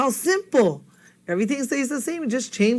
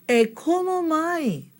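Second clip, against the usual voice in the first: high, slow, drawn-out invitation; inviting call; 8 semitones higher, slower than usual. Shown as a demonstration. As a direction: model the welcome slowly in a raised pitch.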